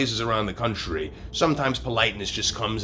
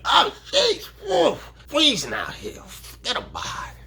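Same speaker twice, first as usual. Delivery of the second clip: put-on Brooklyn accent. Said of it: "you're like".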